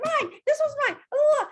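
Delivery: high, loud squeal of "Mine"; squealing imitation of grabbing guests; greedy grabbing women. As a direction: mimic the women grabbing stock. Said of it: "They're like".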